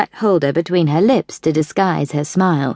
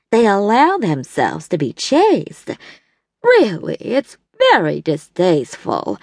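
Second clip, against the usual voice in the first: disapproving. A narrator's reading. Evelyn condemning Trixie at the bridge table, in prim disdain. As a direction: look down your nose at a wayward girl.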